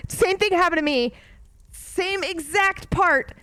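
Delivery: rising high and loud, all worked up; excited agreement. Real, not acted. Streamer gushing to chat about the book, excitedly. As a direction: gush that the same part got you too.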